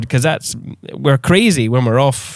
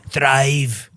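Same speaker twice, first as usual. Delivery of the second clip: Scottish accent. Shown as a demonstration. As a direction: fake Scottish accent on Thrive. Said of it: "say it with more Scottish accent".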